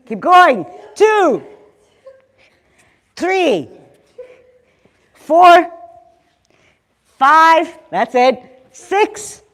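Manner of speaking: loud, punchy fitness count; coach counting reps. Acted. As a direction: call the reps out to the class. Leads the class counting reps.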